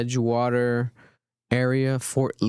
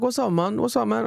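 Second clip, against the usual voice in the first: put-on higher-pitched African-accented greeting; thick African accent; 9 semitones higher than usual. Fan greeting him at the mall, in a thick African accent. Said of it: "he has the accent".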